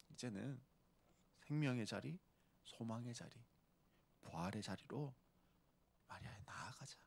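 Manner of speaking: Jesus gently trails into a whisper; fading to a whisper. Retold or imitated. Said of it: "예수님이 갑바가 있다고".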